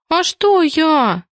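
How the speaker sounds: high, drawn-out squeak; small high protest of innocence. A narrator's reading. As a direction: squeak it innocently, stretching the words. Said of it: "пискнула Киска".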